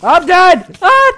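high, loud panicked yell; panicked scream. Real, not acted. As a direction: shriek as you die. Dies to spiders.

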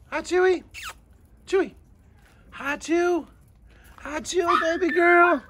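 sing-song greeting to a dog, pitched well up; high pet-talk coo. Real, not acted. Cooing at a dog.

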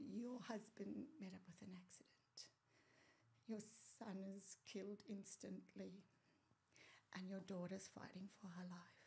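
soft whispered news; hushed. Retold or imitated. Police telling her of the accident, hushed.

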